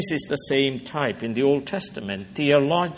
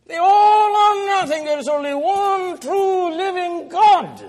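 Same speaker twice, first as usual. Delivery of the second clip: much higher, louder proclamation; preaching shout; 5 dB louder than usual. Real, not acted. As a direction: thunder it from the pulpit. Preaching climax.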